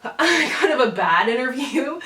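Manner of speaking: laughing through the words, slowed down. Real, not acted. Telling viewers about a bad interview, laughing. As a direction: giggle out the memory of a bad interview.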